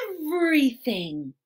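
usual speaking voice

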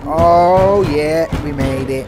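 loud, excited celebrating; triumphant cheering. Real, not acted. Player having escaped the chef, loud triumphant cheer.

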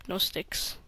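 ordinary talk, nothing marked

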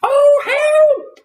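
very high, loud shriek for help; screamed. A narrator's reading. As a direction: scream for rescue in Missus Twit's high voice. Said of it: "Missus Twit screamed".

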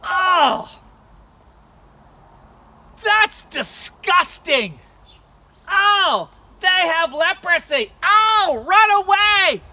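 high, loud cries of revulsion; exaggerated childish disgust; disgusted kids. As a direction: recoil and shout like grossed-out children. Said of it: "The kids would come by".

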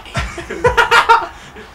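cracking up high; real laughter bursting out. Real, not acted. Laughs at his own line.

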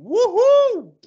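high loud whoop of a fake crowd; crowd cheer imitation.

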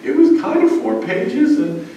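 high, pleading distraught student voice; imitating a tearful freshman in high pitch. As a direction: whine it up high like a panicked freshman. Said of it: "they come to my office in tears".

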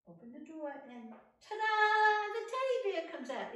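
put-on loud, high ta-da for the kids; excited reveal. Storyteller to children, loud and high excited reveal. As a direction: make the reveal a big surprise.

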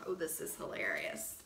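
usual speaking voice

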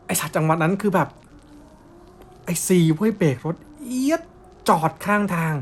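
ordinary delivery, nothing marked

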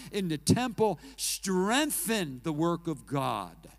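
loud, rising sermon delivery that builds and builds; full force preaching. Acted. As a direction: thunder it out from the pulpit. Triumphant turn of story.

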